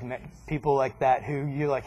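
ordinary talk

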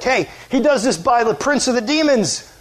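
imitating outraged accusers; acted accusation.

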